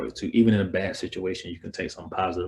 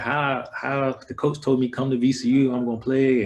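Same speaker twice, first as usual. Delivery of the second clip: raised, incredulous retelling of the coach's promise; indignant complaint; 6 semitones higher, 5 dB louder than usual. Retold or imitated. Player telling campers about the coach's broken promise, incredulous. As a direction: protest it like you were cheated. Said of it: "I'm like".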